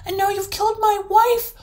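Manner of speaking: high, sustained raving rage; enraged troll yelling every phrase; furious yell. A narrator's reading. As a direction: rant at full volume, bursting with rage. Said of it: "yelled the troll furiously".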